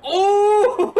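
high, loud surprised ooh; a loud startled ooh of delight; excited high whoop. Real, not acted. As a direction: react to a great play. Big play reaction.

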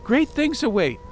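high, loud proclamation; grand promise. Acted. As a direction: lift it like a prophecy.

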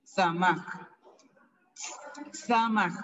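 "samaj" twice, each after a hissed ts; model pronunciation. Shown as a demonstration. Teacher repeating the word for nose, "samaj" with hissed ts. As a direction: repeat "samaj" with a marked ts onset and a pause between. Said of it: "nariz es".

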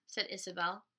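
ordinary delivery, nothing marked

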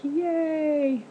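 high little yay of delight; real happy squeal; excited cheer. Real, not acted. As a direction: celebrate the find. Finally got egg beaters.

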